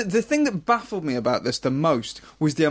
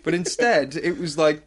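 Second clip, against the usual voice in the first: ordinary talk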